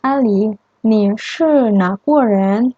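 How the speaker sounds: Anna's question, read slowly and clearly; lightly voiced Anna, slow for learners; slow dialogue line. Shown as a demonstration. Teacher reading Anna's line to students, slow and clear. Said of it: "Anna shuo".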